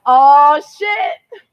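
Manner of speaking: sudden high-pitched shock, then drawn out slowly; startled exclamation. Retold or imitated. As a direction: spot her suddenly and blurt it out high. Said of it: "And then you're like".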